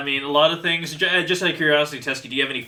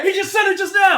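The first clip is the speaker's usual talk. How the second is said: much higher, loud laughing outburst; laughing shout; 7 dB louder than usual. Real, not acted. Laughing accusation.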